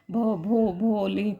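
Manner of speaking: halting, repeating the first sound; stammer. Retold or imitated. Shy girl stammering out her own name to the teacher. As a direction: stumble over the name like a scared girl. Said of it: "इस तरह से वो stammer कर रही होती है".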